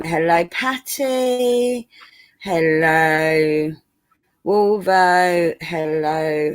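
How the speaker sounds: very drawn-out mock posh greetings; slow, lingering posh roll call. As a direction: stretch each hello and name in a put-on posh accent. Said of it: "I was putting on my posh voice".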